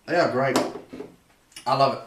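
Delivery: ordinary talk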